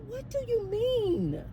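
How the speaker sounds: high, soft, slow and careful; concerned questioning. Retold or imitated. Manager retelling her reply to crying resident, concerned. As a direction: gently coax an upset caller.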